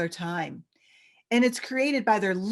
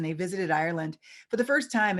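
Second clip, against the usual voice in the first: usual speaking voice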